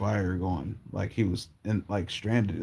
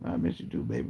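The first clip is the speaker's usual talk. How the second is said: put-on whispered, high and gentle like an old grandmother; soft grandma voice; 4 semitones higher, 5 dB quieter, faster than usual. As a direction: breathe it tenderly as grandma. Grandma answering in dream.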